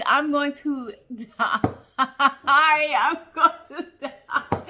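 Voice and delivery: dying of laughter, slowed; cracking up at the joke; laughing. Real, not acted. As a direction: laugh through every word. Laughing at the gag gift.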